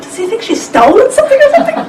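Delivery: high-pitched, laughing mock disbelief; retold disbelief. Speaker retells mistaking the drama for real, high and incredulous. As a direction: imitate your own bewildered question through laughter.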